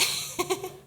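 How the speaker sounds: quick airy laugh at own joke; short amused laugh; breathy laugh. Real, not acted. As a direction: laugh it off lightly.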